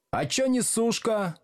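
high-pitched cheeky call; heckling shout. A narrator's reading. Pupils at back desks heckle the teacher, shouting. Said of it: "крикнули с задних парт".

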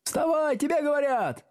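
very high, shrieking urging; eager shouting. A narrator's reading. Classmates urging the pupil up, high eager shout. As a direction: shout it shrilly as helpful classmates. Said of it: "услужливо закричали несколько голосов".

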